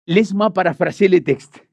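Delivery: ordinary talk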